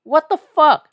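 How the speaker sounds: what-the-hell outburst; incredulous. Retold or imitated. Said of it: "I go".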